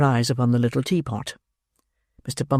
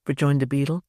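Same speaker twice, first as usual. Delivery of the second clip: ordinary delivery, nothing marked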